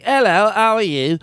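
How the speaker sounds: high, exaggerated British dialect; very strong British accent. Shown as a demonstration. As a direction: lay the British accent on thick. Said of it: "There's a very strong one that's like".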